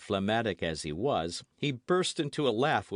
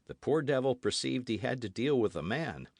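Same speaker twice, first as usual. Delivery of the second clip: usual speaking voice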